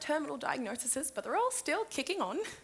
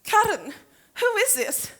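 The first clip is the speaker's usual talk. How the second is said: stunned; 9 semitones higher, 7 dB louder, slower than usual. Acted. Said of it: "Ethel is stunned".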